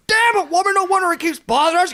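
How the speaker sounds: loud, high mock scolding; South Park husband. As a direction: scold the wife in cartoon outrage. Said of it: "immediately I went to South Park".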